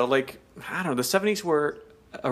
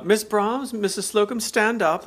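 higher, instructing manager voice; bossy manager; 6 semitones higher than usual. Retold or imitated. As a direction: call them up like a manager. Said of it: "Mister Rumbold has to explain each step".

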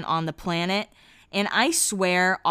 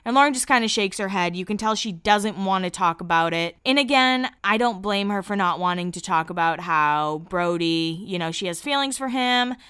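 ordinary talk, nothing marked

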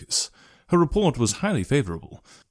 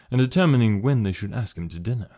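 plain reading voice

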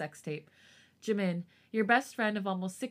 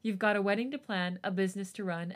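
ordinary delivery

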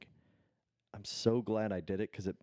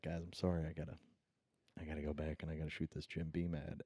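retold apology; 4 semitones lower, 7 dB quieter, faster than usual. Retold apology at father's reception.

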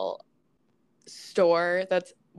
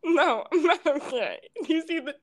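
exasperated retort; 7 semitones higher, 3 dB louder than usual. Retold or imitated. Retorting to the strangers.

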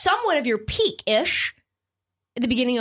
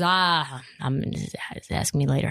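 mumbling Doc Rivers; 10 semitones lower than usual. Retold or imitated. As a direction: mutter it away like a coach dodging questions. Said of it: "Doc Rivers famously says".

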